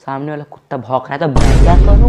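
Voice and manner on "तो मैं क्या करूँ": loud, higher rising outburst; exasperated shout. Acted. Son to mom about the barking dog, exasperated shout. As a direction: yell what do you want me to do.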